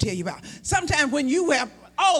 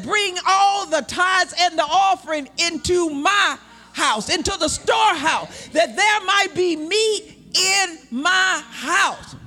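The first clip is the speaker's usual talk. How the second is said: slow, booming voice of God; slow thundering divine command; preaching God's voice; 4 semitones higher, slower than usual. Retold or imitated. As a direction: proclaim it slowly with full force. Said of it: "He said".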